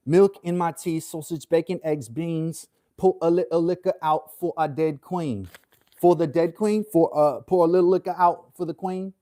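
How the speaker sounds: hammed-up British accent rapping the lines. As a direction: rap it in a put-on British accent. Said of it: "does a better British accent".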